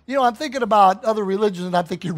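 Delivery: ordinary talk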